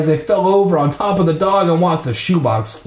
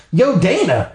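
cheery greeting; 7 semitones higher than usual. Acted. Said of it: "She greeted me".

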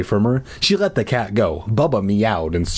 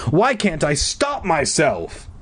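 anguished; 7 semitones higher, slower than usual. A narrator's reading. Boy helpless against replaying day.

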